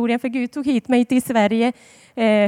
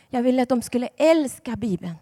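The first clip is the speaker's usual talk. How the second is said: ordinary delivery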